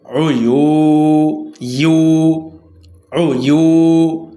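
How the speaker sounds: "উয়ু" with the long u held out slowly; slow tajweed demo, "উয়ু" and "ইউ" drawn long; elongated vowel. Shown as a demonstration. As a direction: stretch the final vowel of "উয়ু" on a level tone. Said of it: "এক আলিফ টানিয়া পড়িতে হইবে".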